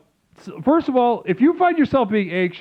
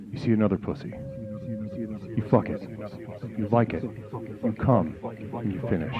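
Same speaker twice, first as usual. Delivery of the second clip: mock guided meditation; 5 semitones lower, 9 dB quieter than usual. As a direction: intone each line softly like a meditation guide. Parody guided meditation mantra.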